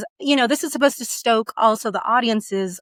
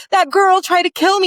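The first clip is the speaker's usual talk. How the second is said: panicked; 9 semitones higher, 5 dB louder than usual. Acted. Terrified guest insists.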